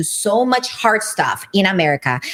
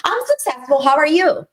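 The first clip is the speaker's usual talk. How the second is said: much higher, sing-song, put-on politeness; sassy lady; 8 semitones higher than usual. Quoting a viral clip lady greeting someone in a high sassy voice. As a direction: channel the clip lady's cheeky greeting. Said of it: "She's like".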